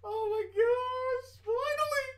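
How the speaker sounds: high excited. Real, not acted. Streamer marvelling to viewers that it finally starts, high and slow. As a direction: stretch it slowly, overjoyed.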